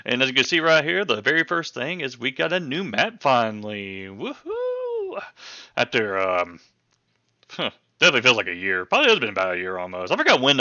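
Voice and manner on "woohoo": excited woohoo. Real, not acted. Cheering new map.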